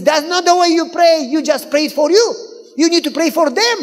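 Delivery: high, loud exasperated scolding; scolding correction. Retold or imitated. Wife correcting husband's selfish prayer, high and loud. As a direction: set him straight, fed up.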